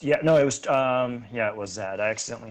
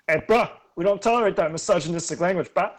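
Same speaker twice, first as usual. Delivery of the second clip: mock scolding bro; 5 semitones higher, 7 dB louder, faster than usual.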